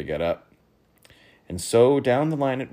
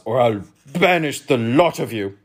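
loud, raised royal threat; thundering; 4 semitones higher, 8 dB louder than usual. A narrator's reading. King thundering a threat at brawling guests. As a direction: threaten them loudly as the king. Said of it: "thundered Dazaz".

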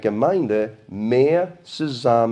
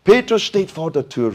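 calling out; 5 semitones higher, 5 dB louder than usual. A narrator's reading. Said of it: "rief sie".

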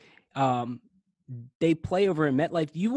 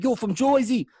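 put-on Jersey accent; 10 semitones higher, 4 dB louder than usual. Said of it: "Not in the Jersey accent".

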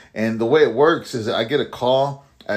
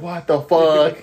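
louder, stunned exclamation; shocked outburst; 5 semitones higher, 5 dB louder, much slower than usual. Retold or imitated. Kicked guard retelling his reaction, stunned exclamation. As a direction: snap out the words in disbelief. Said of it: "I was just in shock".

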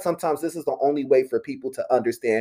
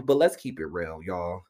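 low candid aside; 7 semitones lower, 7 dB quieter than usual. Real, not acted. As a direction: lower and much softer, confiding.

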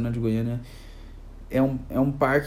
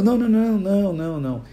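raised, rapid-fire objection; hurried protest; 4 semitones higher than usual. Acted. Owner cutting off a screaming mother, acted urgent protest. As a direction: jump in with higher, urgent repeats.

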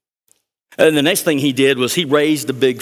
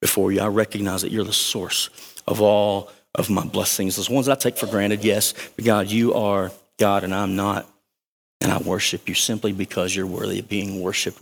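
soft, lower, reverent prayer; a humble prayer on bended knee, soft and low; 5 semitones lower, 5 dB quieter than usual. Pretend. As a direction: pray it quietly on your knees. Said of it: "got on your knees and just said".

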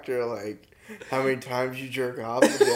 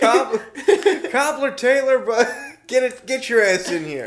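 mock parental summons, pitched up through giggles; parent calling full name; 8 semitones higher than usual. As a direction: call the kid in by full name, half laughing. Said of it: "use all your names at the same time".

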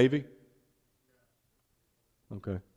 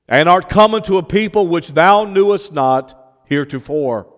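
scripture reading; 3 semitones higher, 4 dB louder than usual. Acted. Preacher reads Boaz's answer to the congregation, declaimed scripture reading. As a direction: proclaim it loudly and deliberately, easing down at the end.

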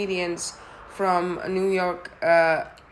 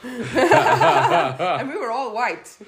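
slow, higher, louder, laughing hard; 6 semitones higher, 7 dB louder, much slower than usual. Real, not acted. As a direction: laugh the words out. Laughing through punchline.

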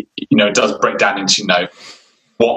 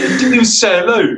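mock outrage; 12 semitones higher than usual. Retelling a rude passerby.

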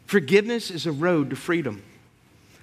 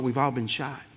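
softer, lower reflective echo; quiet repeat; 5 semitones lower, 6 dB quieter than usual. Real, not acted. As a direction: let it sink in quietly. Sermon applies the movie line.

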